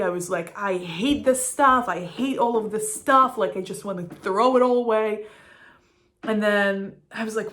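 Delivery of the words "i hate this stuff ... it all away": louder, exasperated mock rant; retold angry rant. Vlogger replaying her cleaning frustration, mock angry. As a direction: vent at the clutter, hammed-up. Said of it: "I was feeling angry".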